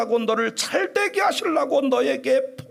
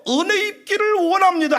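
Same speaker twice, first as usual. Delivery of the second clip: humble reply; 5 semitones higher, 4 dB louder than usual. Shown as a demonstration. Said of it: "엘리같이 말을 해도".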